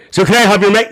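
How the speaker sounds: ordinary talk, nothing marked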